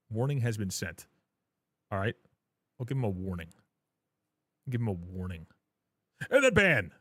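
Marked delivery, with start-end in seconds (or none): 6.3-6.9 yelled high "ban!" like a gavel; sudden shout. Acted. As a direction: slam the word ban. Chat moderation rules, dramatic ban.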